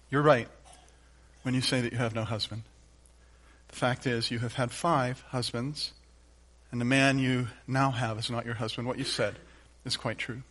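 soft, low, gentle telling; gentle Jesus. Shown as a demonstration. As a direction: say it quietly, sparing her the shame. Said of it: "says the words that might be just a little too difficult for her to admit".

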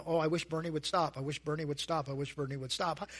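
low, soft, fading sigh of a wish; mock wistful wish. Someone silently wishing Bernie would stop, low fading wistful murmur.

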